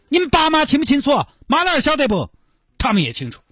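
high, indignant demands; demanding girlfriend. Acted. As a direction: list the compensation stubbornly. (高音理直气壮地要赔偿)

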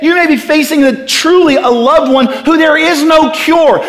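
high-pitched, earnest insistence; impassioned preaching. Real, not acted. Preacher to those facing sickness without cure, impassioned. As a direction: press each hardship home with urgency.

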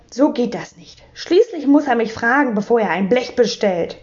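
ordinary delivery

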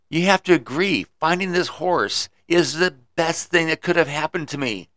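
bursting with good news; excited farmer. Acted. Farmer boasting to the wise man, excited. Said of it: "the farmer was so excited".

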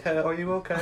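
a hint of a timid small child; mock five-year-old. Grown man reduced to a kid asking girlfriend, lightly childlike. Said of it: "went from being, like, twenty to, like, five".

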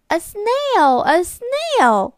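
high, loud excited shouting; excited children. Acted. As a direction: shout out in surprise. Children spot the snail.

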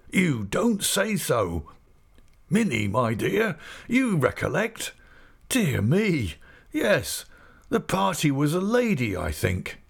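put-on old man delighted by a sudden memory; old Mister Omer.

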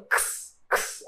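"Ks" voiceless, a click of k into a hiss; two separate whispery bursts of "ks"; isolated KS cluster. Shown as a demonstration. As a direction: sound out "ks" with no vowel. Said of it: "É como se eu tivesse assim".

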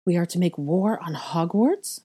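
ordinary delivery

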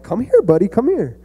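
high, sweet coaxing call rising into a falsetto; coaxing uncle. Retold or imitated. Uncle luring his wary nephew close, high and sugary. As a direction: sing it out like baiting a puppy over. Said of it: "and I'm like".

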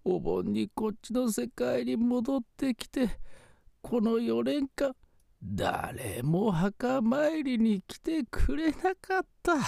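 put-on slow, high old ghost; quavering grateful spirit grandpa. Ghost grandfather speaks to woman.

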